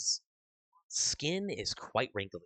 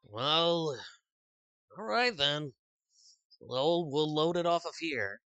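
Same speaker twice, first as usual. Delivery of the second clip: npc dockworker; 5 semitones higher, 4 dB louder than usual. Acted. GM voicing NPC, higher voice.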